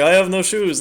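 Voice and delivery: put-on half-hearted go at a Czech accent; attempted Czech accent. Gamer repeating the shoeless player's line, attempted Czech accent. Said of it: "a Czech accent".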